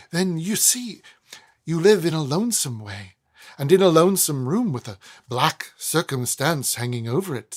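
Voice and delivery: a polite, fretful little tradesman; Snagsby voice. A narrator's reading. Snagsby sniffing the air to Weevil, mild and timid.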